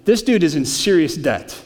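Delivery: normal talking voice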